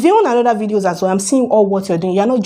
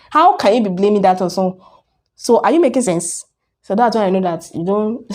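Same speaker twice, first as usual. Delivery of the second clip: ordinary talk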